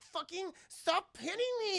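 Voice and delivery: high-pitched squabbling voice; mock catfight whine. Sonya and Mileena mud wrestling, high mock whine.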